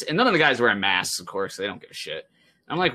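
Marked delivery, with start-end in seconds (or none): none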